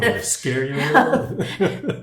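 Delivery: high, loud laughing voices. Real, not acted. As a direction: laugh through the words. Both laughing, voices jumping high.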